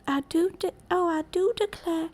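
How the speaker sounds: Southern Belle accent. Shown as a demonstration. Streamer trying out a Southern Belle accent. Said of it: "like, a Southern Belle accent?".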